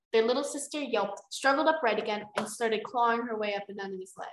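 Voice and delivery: ordinary delivery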